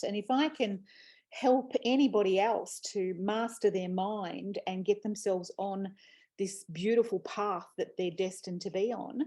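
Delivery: ordinary talk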